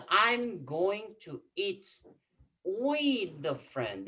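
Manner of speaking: slow, deliberate English gloss; slow translation. Shown as a demonstration. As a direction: spell the meaning out word by word. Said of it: "slow translation for class".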